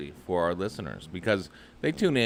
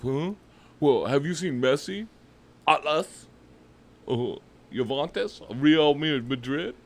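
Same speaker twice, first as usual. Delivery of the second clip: higher put-on voice with halting questions; mocking coworker imitation; 5 semitones higher than usual. Imitating the soccer-obsessed coworker for the co-host, high mocking voice. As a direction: mimic a clueless soccer obsessive. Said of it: "And he's like".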